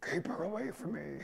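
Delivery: whisper-ish plea to keep her away; understated imitation of a wife's hiss; mock hushed plea. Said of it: "and he was like".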